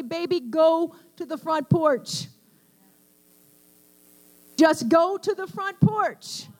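emphatic reassurance. Acted. God answers someone beating on a closed door, emphatic reassurance. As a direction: speak as God to a beloved child, slowly and insistently.

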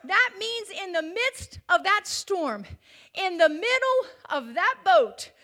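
preacher thundering about the storm; preaching at full force. Acted. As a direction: drive it home with full force. Preaching builds again.

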